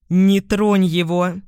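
a touch of an alien, possessed voice; someone else's voice. A narrator's reading. Кэт blocking Бон's attack, slow forceful voice not her own. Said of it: "чужим голосом сказала она".

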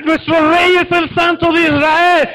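loud, high, slow war cry; grito de guerra. Shown as a demonstration. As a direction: lead the army in a slow shout. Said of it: "griten otra vez". (grito de guerra fuerte y lento)

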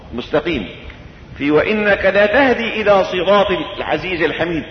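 usual speaking voice

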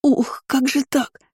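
high, drawn-out wail of pain; pained howl. A narrator's reading. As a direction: howl it like a wounded she-wolf. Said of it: "взвыла раненой волчицей".

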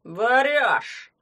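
put-on loud accusing drunk; drunken shout. A narrator's reading. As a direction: bark it at the boy. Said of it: "Пьяный погрозил ему пальцем".

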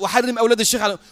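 fast parroted prayer echo; repeated prayer. Retold or imitated. Samara repeating the sheikh's dictated prayer, quick echo. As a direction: hurry the supplication back, obediently. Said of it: "وسمارة يقول".

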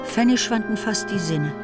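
usual speaking voice

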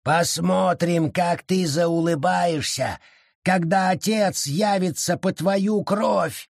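high, slow menacing creature voice; gloating threat; threatening forest spirit. A narrator's reading. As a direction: warn her with relish.

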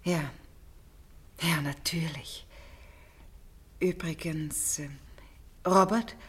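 slow hushed whisper; whispering slowly, voice almost gone. Acted. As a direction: drop to a breathy whisper before the hard question. Hushed before confronting Robert.

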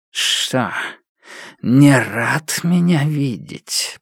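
slow hissing shadow voice; creeping nightmare whisper. Acted. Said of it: "прошипела тень".

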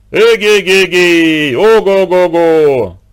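long drawn-out far-off call, loud and high; distant halloo. A narrator's reading. A man calling to the children from far off, drawn-out halloo. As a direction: halloo across the forest. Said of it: "донёсся далёкий-далёкий крик".

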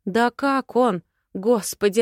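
usual speaking voice

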